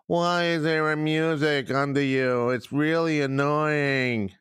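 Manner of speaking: slow, drawn-out whiny complainer; mocking whine. Host mimicking complaints about the new music, mocking whine. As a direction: imitate a moaning listener, dragging the words. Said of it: "And then all this shit happened".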